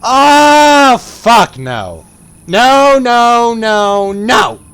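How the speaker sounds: panicked high yelling no; scared screaming. Real, not acted. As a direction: scream in real terror. Horror game jump scare.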